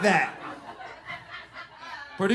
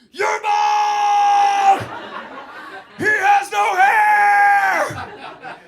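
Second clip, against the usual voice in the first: high, loud mocking shout; stretched-out taunting kids' shout; kids yelling; 12 semitones higher, 12 dB louder, much slower than usual. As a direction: yell it like a class of kids.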